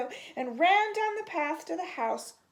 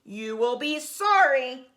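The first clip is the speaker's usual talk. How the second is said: very loud furious threat; the furious shark screams a threat at the pigs; 10 dB louder than usual. A narrator's reading. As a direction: scream it with all your rage. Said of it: "screamed the furious magic shark in his loudest voice".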